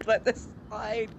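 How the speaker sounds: usual speaking voice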